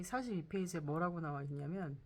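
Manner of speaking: ordinary talk, nothing marked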